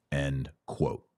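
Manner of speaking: normal talking voice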